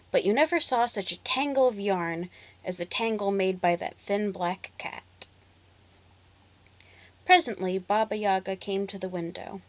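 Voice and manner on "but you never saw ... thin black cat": ordinary delivery, nothing marked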